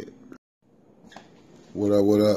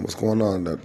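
ordinary delivery

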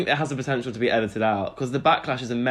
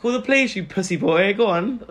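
mocking taunt; 7 semitones higher than usual. Taunting [name].

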